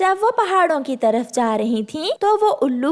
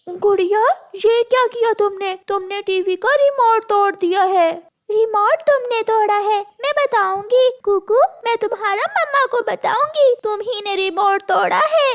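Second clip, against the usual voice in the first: put-on high, accusing child voice; blaming child; 8 semitones higher than usual. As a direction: threaten to tell mum. Siblings blaming each other.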